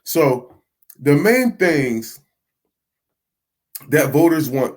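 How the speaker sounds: ordinary delivery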